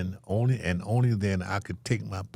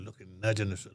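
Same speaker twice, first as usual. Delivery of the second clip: ordinary talk, nothing marked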